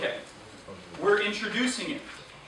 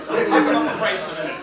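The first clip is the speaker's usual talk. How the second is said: fast and louder through laughter; laughing; 6 semitones higher, 5 dB louder, faster than usual. Real, not acted. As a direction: repeats the joke while cracking up. Repeats punchline laughing.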